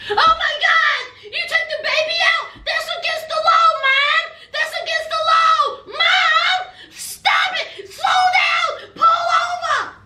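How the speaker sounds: high frantic shouts; panicked shrill yelling. Retold or imitated. Paul panicking, shouting at mom.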